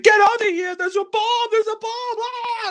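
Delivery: high-pitched hammed-up alarm shout; shrieky parody of panic; mock panic. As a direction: imitate a frantic bomb warning, voice way up high. Acting out film's bomb panic.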